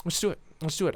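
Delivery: soft, tentative resolve; nervous self-urging. Acted. Kid holding a dagger, softly psyching himself up. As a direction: talk yourself into it under your breath.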